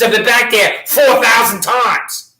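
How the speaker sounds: loud, slow, hammering each word; angry shout. Real, not acted. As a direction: shout it out, furious and deliberate. Angry public comment, raised voice.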